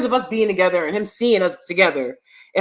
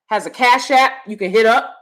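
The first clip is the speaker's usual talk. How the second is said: usual speaking voice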